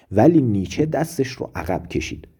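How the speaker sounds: ordinary delivery, nothing marked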